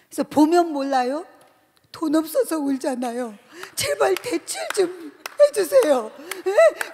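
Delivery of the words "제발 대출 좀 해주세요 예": tearful pleading. Retold or imitated. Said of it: "그러면서 막 더 세게 울었어요".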